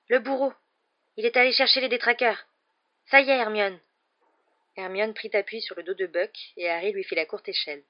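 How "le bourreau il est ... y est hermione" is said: higher, louder tense warning; alarmed urgency. Acted. Harry warning Hermione the executioner is coming, urgent and loud. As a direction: sound the alarm in a rush.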